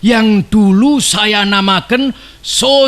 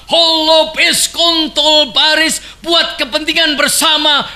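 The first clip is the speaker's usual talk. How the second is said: rousing shout; 6 semitones higher than usual. Real, not acted. Orator building to rallying cry.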